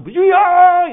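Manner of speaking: 아주 높고 크게 뽑는 외침; 통성 기도를 흉내 내어 목청껏; 부르짖는 기도 흉내. Retold or imitated. (길게 내지르는 기도 소리)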